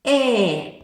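"Е" sung out loud with a laugh. Shown as a demonstration. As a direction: show it the way the girl says it, loudly. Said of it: "як дівчинка говорить цей звук". (голосне «Е» зі сміхом)

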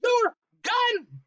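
very high screeched order; high-pitched, urgent screech. A narrator's reading. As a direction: shriek the command. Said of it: "she screeched".

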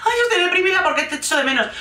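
high, fast, whining mockery of her; hammed-up self-pitying whine; mock whiny girlfriend. Mocking Holly's clingy phone call in a whiny voice. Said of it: "imagínate una conversación con esta".

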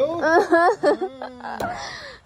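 half laugh, half mock sob; laugh-cry. Mother to son, laughing through her mock sobs. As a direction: giggle breaking into a fake whimper. Laughing while fake crying.

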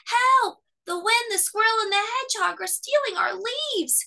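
high, loud frenzied cry. A narrator's reading. Cub cries for help again, frenzied. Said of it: "cried frenzied Fletcher".

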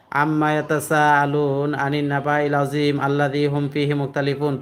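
rattled off quickly without tarteel; hurried plain reading. Shown as a demonstration. Teacher mimics plain reading without practice. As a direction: read it through as ordinary rushed reading. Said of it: "পড়া কারে কয়".

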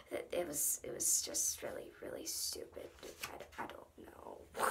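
hushed, cringing whisper; embarrassed whisper. Real, not acted. Embarrassed about old joke.

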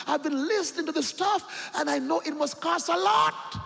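a hint of an eager young businessman; preacher voicing rich young man, high; earnest young man. Preacher voices young millionaire.

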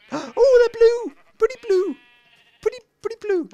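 2 high, delighted squeal with a gasp; 3 squeal it like the sheep are the best thing ever. Real, not acted. 4 gamer sees his sheep turn blue, high excited squeal.

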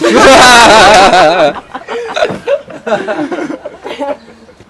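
long loud laughing fit. Real, not acted. Group cracks up at scene.

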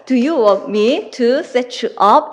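very slow, word by word; slow dictation. Shown as a demonstration. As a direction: spell it out slowly for students to write. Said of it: "이렇게 얘기를 하시면 돼요".

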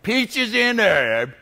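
a slow, lightly voiced smooth late-night DJ; radio DJ. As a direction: croon the dedication like a radio host, drawn out. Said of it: "Jock would come on and he'd go".

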